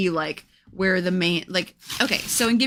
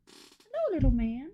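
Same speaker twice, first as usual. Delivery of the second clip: much higher and softer, hello squeaked way up; 7 semitones higher, 4 dB quieter than usual. Real, not acted. To her cat, an even higher squeaky baby-talk hello.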